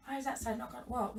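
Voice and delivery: ordinary talk, nothing marked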